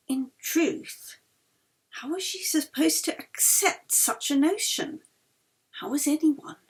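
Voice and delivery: breathy whisper of doubt; whispered thought. A narrator's reading. Yeli alone in her room, doubting, whispered. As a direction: hush it, an inner question.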